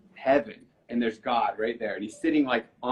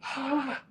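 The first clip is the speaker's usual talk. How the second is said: amazed gasp; 13 semitones higher, 3 dB quieter than usual. Retold or imitated. Waking from the dream, amazed.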